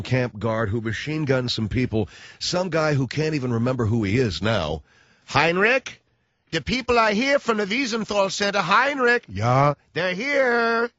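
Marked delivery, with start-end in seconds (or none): 5.3-10.9 calling out. Retold or imitated. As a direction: call Heinrich to the door. Acted arrest of old Nazi.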